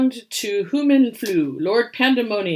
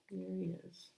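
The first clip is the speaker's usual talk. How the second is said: plain reading voice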